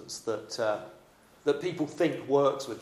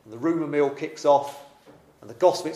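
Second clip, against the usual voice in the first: ordinary delivery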